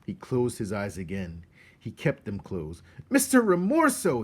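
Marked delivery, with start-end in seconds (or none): 3.1-4.2 high, loud desperate shout. Acted. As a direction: shout out in panic. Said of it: "he shouted".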